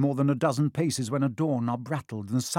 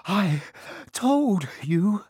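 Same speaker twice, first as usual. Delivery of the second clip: higher, louder gasping words; breathless and a touch higher; gasped; 7 semitones higher, 4 dB louder, much slower than usual. A narrator's reading. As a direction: gasp it out with a stitch. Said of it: "Hermione gasped".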